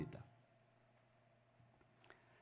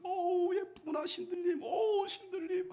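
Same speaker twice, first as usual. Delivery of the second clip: leering king; 12 semitones higher than usual. Acted. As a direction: croon like a besotted old king. Herod admiring the dancing daughter.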